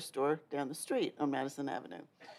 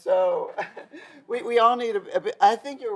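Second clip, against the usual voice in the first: laughing; 6 semitones higher, 5 dB louder than usual. Real, not acted. Laughing after the punchline.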